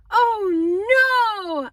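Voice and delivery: high, loud, drawn-out cry of dismay; long wailing cry of horror; horrified wail. A narrator's reading. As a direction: wail it out in horror, stretching the vowels. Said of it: "I let out a wail of horror".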